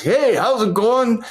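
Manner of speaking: big friendly greeting, high and drawn out; John Madden. Retold or imitated. Actor imitating his hero's friendly hello, sweetie-pie greeting. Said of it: "He's a sweetie pie".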